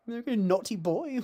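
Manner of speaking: high, coy camp voice; lilting hammed-up naughtiness; camp impression.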